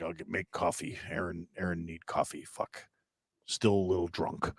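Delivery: low, soft, groggy mumble; half-asleep caveman talk before coffee; groggy and drunk. Retold or imitated. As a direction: mumble it like a tipsy morning grump. Retold groggy hungover morning mumble.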